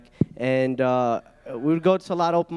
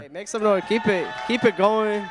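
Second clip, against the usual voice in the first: hyping the crowd; 6 semitones higher, 5 dB louder than usual. Real, not acted. Comic hypes crowd on stage.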